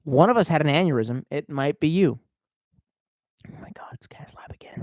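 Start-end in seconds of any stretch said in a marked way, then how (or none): 3.5-4.8 unvoiced exasperated whisper; whispered mock dread at a repeat chatter. Real, not acted. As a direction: hush it like a conspiratorial groan. Whispered aside about chatter.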